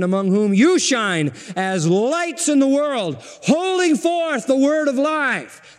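verse proclaimed slowly, climbing high; preaching. Real, not acted. Preacher quoting Philippians to his church, proclaiming it high and slow.

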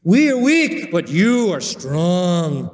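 loud high start, then drawn-out mock praise; sarcastic. Preacher as Paul comparing apostles with Corinthians, sarcastic. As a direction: throw the contrast at them with heavy sarcasm. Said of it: "watch how sarcastic he gets".

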